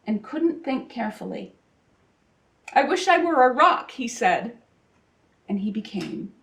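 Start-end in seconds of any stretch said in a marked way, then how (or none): 2.7-3.9 higher, louder panicked blurt. Acted. Sylvester facing the hungry lion, panicked blurt. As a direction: blurt it out in a sudden fright. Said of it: "but he panicked".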